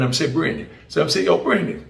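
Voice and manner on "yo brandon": light Jamaican patois, as in the prison yard; retelling a Jamaican inmate, mild Jamaican accent. Retold or imitated. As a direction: slip into Jamaican patois for the quote. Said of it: "Now Jamaican".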